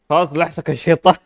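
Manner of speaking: loud high cackle after a mock curse; laughing. Real, not acted. Gamer jokes a curse at the baby, then laughs loudly. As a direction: crack up at your own joke.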